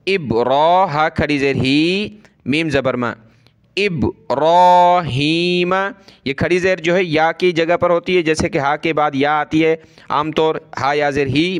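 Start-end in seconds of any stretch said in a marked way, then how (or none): 3.8-5.7 slow drawn-out recitation of इब्राहीम; recited Quran word. Shown as a demonstration. Teacher shows pupils how to recite इब्राहीम, slow and drawn. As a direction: draw out इब्राहीम slowly in tajweed style. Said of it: "तो इसको इस तरह पढ़ें".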